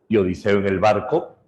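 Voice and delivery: usual speaking voice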